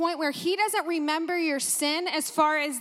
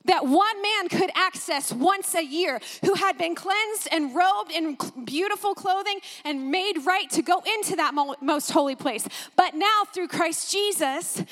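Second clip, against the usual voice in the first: fervent preaching; 3 semitones higher than usual. Real, not acted. Preacher telling believers they are God's temple, fervent preaching. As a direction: proclaim it with mounting excitement. Preaches excitedly on holy place.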